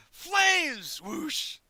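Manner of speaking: high, loud flame burst; dramatic fiery shout. Retold or imitated. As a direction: blast it out like fire erupting. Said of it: "it's supposed to go".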